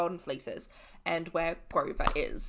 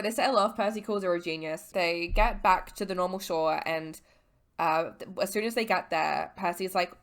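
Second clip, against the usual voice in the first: ordinary talk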